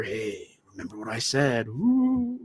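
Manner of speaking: hushed mock-ghost voice with a rising wail; ghostly warning. Dead father appearing to warn Peter, mock ghostly. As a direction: lightly imitate a spooky apparition. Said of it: "warning Peter".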